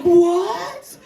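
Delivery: high, loud squeaky question; mock childlike surprise; surprised child.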